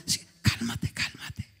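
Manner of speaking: hushed, anxious whisper; nervous whisper. Retold or imitated. Nervous coworker hushes the pastor at his workplace, whispering. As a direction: whisper urgently so coworkers won't hear. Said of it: "todo, todo nervioso me dice".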